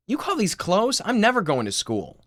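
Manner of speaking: yelling sister. Acted. Sister complaining about her clothes, yelling down. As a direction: call it out from upstairs in outrage.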